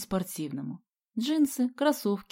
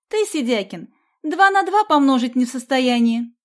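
loud, high insult; heated, scornful shouting; angry taunt; 5 semitones higher, 9 dB louder than usual. A narrator's reading. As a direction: lash out at a classmate. Соня кричит на Сидякина в ссоре.

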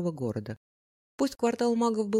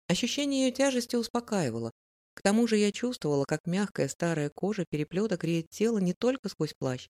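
ordinary delivery

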